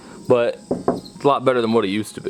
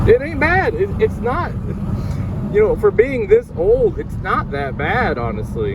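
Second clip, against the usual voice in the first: muffled; 11 semitones higher than usual. Real, not acted. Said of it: "I'm sorry if my voice is super muffled".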